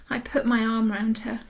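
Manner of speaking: usual speaking voice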